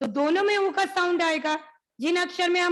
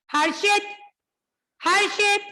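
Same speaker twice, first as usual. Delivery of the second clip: calling the student's name, rising louder; 6 semitones higher, 5 dB louder than usual. Real, not acted. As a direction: call him sharply to attention. Teacher calls a student by name.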